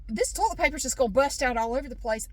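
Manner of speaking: ordinary talk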